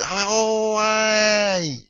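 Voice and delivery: long, high drawn-out "oh, aye"; sudden realisation. Retold or imitated. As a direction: stretch it out high as it dawns on you. Acted sudden realisation on call.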